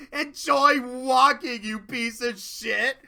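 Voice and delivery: mock-villain gloating; cruel taunt. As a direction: draw out the insult at full volume. Mean twin taunts the bird.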